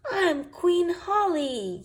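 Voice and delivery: high, slow giggly boast; mild giggle in a high voice; giggling. Acted. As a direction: giggle it out proudly. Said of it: "giggles Holly".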